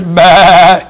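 a short, nasal imitated sheep's "bah"; sheep bleat. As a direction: bleat it like a sheep hiding in the camp. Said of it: "bleating of the sheep in my ears".

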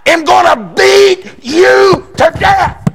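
slow, high furious shout of a threat; dad's threat. Retold or imitated. Preacher shouting his dad's threat to beat him. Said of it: "he says".

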